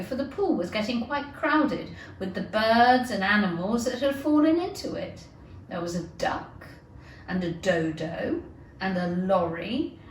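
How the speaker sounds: plain reading voice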